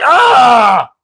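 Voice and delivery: high-pitched drawn-out "ah"; high, sustained mock yell; retold cry. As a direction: let out a long, high cry of reaction. Reacting to intangibles in retold story.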